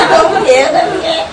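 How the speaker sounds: speaks through real laughter; real laughter after the husband's line; laughing. Real, not acted. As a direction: laughs while finishing the line.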